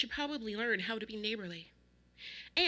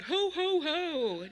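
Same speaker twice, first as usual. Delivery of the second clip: loud, high Santa-like ho-ho-ho; jolly booming holler; jolly shout; 7 semitones higher, 6 dB louder, much slower than usual. A narrator's reading. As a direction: belt out a booming mock laugh. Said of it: "I expected him to shout".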